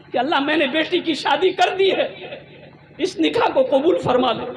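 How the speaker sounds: imitated tearful supplication; tearful prayer. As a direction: plead as if through tears. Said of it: "रो रहा था".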